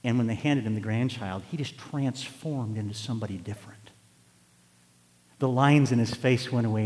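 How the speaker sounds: ordinary talk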